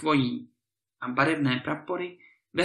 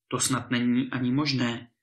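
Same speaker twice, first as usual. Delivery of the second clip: usual speaking voice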